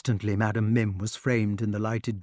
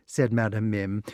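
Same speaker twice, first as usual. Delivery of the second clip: ordinary delivery, nothing marked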